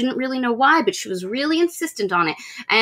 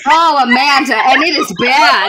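loud dismay; 3 semitones higher, 6 dB louder than usual. Real, not acted. Dreading the audiobook.

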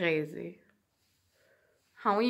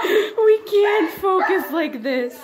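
laughing; 9 semitones higher, 6 dB louder than usual. Real, not acted. Laughing through words.

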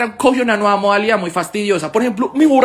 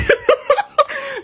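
carcajada. Real, not acted. Laughs at own joke.

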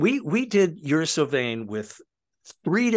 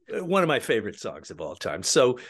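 ordinary delivery

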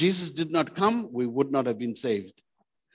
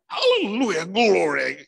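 high, put-on preacher voice, hammed up; mock the showy preacher who raises his voice; exaggerated fake-anointed cry of praise; mock preacher shout; 6 semitones higher than usual. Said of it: "raise your voice and speak with a funny accent".

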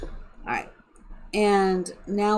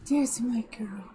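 whispered coo to a pet; hushed, breath-only endearment; adoring whisper. Real, not acted. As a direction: whisper it fondly to the cat. Cooing to the purring cat.

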